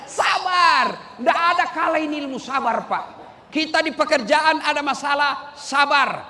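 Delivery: fiery preaching. Acted. Preaching at full force.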